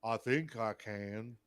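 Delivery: slow Southern drawl in a simple movie-hero voice; Forrest Gump accent. Pretend. Co-host answering whether he can do the accent, Forrest Gump voice. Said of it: "can you do a Forrest Gump accent".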